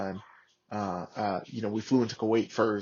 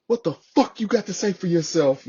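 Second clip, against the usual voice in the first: retold angry demand, raised and louder; cussing out; 7 semitones higher, 5 dB louder than usual. Sergeant major demanding the Marine's side, mock-angry and raised. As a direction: imitate a superior demanding an explanation. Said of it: "He's cussing us out".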